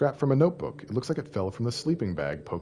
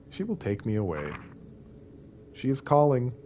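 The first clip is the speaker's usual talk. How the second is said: diary reading; 3 semitones lower than usual. Acted. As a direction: read it like a lonely diary. Reads diary notes in game.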